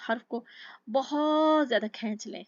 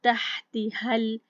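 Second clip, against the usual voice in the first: very slow, louder chanted verse; tajweed recitation; 6 dB louder, much slower than usual. Shown as a demonstration. Teacher recites the next verse for students, slow chant. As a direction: draw the verse out slowly. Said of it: "हा हमज़ के हरूफ में हो गया".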